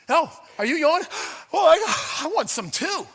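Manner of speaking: hammed-up jealous yawner; mock greedy yawner. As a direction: play the selfish body grabbing air. Acting out the selfish yawner.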